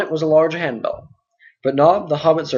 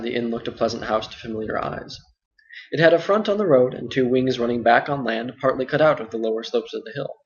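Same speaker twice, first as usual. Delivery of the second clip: plain reading voice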